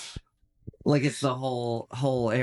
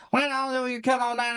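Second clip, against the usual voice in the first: put-on Kentucky accent; 10 semitones higher than usual. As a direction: run it together as an unintelligible Kentucky guy. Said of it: "a Kentucky guy being like".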